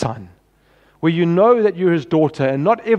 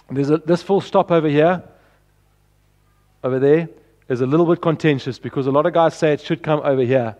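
usual speaking voice

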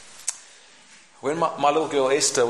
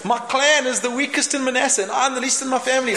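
high, louder squeaky whine; squeaky Gideon; 9 semitones higher, 4 dB louder than usual. Acted. Gideon making excuses to the angel, squeaky. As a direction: whine it in a tiny frightened voice.

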